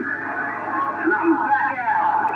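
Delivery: slow words through an echo mic; echo microphone. Real, not acted. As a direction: talk into a toy echo mic. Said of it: "with the Sedell Echo mic on here".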